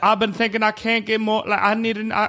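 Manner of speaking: Charles Barkley impression. Shown as a demonstration. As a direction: ramble earnestly like the TV analyst. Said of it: "Charles Barkley".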